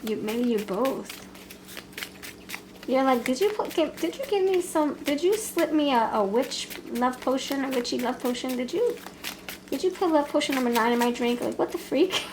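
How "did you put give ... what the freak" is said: high, incredulous questioning; mock suspicious. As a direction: act shocked and accusing, pitched up. Voicing the suspicious lover.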